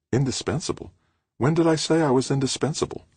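high, incredulous echo; indignant. A narrator's reading. Indignant echo in acted quarrel.

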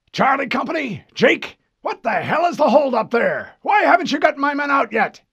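high, loud, demanding officer voice; angry admiral. Acted. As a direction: bark like an impatient admiral. Admiral demands over comm.

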